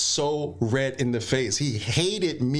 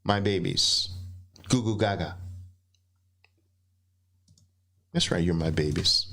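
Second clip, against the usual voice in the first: put-on lower, cooing baby talk; 7 semitones lower than usual. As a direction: coo at the traders like infants.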